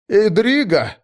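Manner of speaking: high, loud call into the dark. Acted. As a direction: shout for a comrade. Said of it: "позвал он". (громкий оклик)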